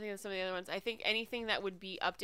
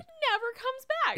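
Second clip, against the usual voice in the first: high emphatic; 4 dB louder than usual. Real, not acted. Repeats line, high emphatic.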